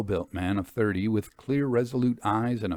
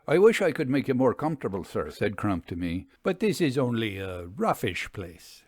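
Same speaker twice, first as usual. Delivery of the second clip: hurried apology. Acted. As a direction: rush the apology out modestly. Crump apologizes to guest.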